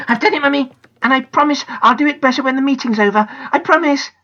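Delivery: put-on high pleading girl's voice; young girl. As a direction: promise earnestly to mum. Janet pleads with Mummy.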